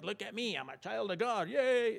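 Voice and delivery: small, light show-off voice rising to a high "yay"; mock bragging. Preacher imitating arrogant believers in heaven, mock gloating. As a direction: parody a boastful cheer, lightly.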